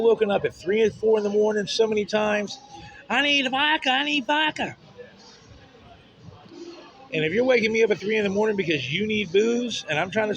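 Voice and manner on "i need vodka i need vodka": high, pleading imitation of her begging; mimicking his girlfriend's plea; mock whiny girlfriend. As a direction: whine it like a craving drunk. Mocking girlfriend begging for vodka.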